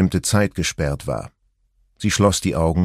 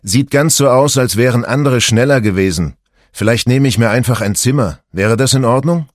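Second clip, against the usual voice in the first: lightly voiced husband, raised and louder; husband on the phone; 5 semitones higher, 5 dB louder than usual. A narrator's reading. Stranded husband phones his wife from a noisy lounge, voice raised. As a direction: speak up lightly over a crowded lounge.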